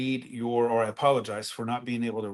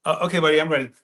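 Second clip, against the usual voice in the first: louder and higher call to the crew; 5 semitones higher, 10 dB louder, much faster than usual. Real, not acted. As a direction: call out you're ready. Calls to crew before air.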